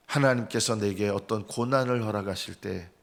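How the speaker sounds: ordinary talk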